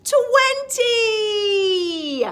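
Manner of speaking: high, loud shouted twenty. Shown as a demonstration. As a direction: shout it out together. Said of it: "Everyone shout".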